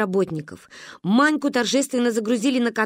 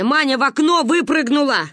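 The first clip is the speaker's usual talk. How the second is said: slightly raised, forceful adult voice; urgent phone report; 4 semitones higher, 5 dB louder than usual. Acted. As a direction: report the emergency firmly. Grandmother explains urgently on phone. (взволнованно, по телефону)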